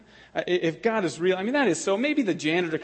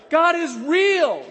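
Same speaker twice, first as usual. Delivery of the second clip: slow, much higher and louder on REAL; emphatic declaration; 10 semitones higher, 6 dB louder, much slower than usual. Real, not acted. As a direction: preach it, stretching God is real. Preacher declares to congregation.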